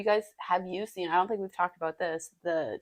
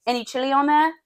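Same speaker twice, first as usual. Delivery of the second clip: high, louder British vendor question; put-on British accent; 6 semitones higher, 5 dB louder than usual. Food-cart seller offering chili to a customer, put-on British accent. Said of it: "he's like".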